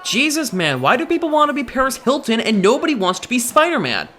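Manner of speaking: high-pitched, worked-up rant; indignant, high-voiced appeal to friends; exasperated protest. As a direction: argue it passionately, pitch climbing. Acted argument about superheroes.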